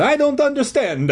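high stage-Italian, barely halting; confused plea in hammed-up Italian; Italian accent stammer. A narrator's reading. Confused janitor answering the kids, Italian accent with little stammer. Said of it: "he stammered".

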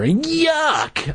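high-pitched cry of disgust; exaggerated squeal of revulsion. A narrator's reading. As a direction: recoil from a stink, voice leaping up. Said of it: "I said, making a face".

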